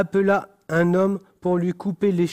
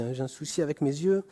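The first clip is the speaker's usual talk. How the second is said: ordinary talk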